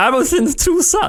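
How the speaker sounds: high-pitched, imitating own flustered voice; retold awkward high pitch; embarrassed high voice. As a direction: squeak it out, trying to save face. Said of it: "this is fully my voice".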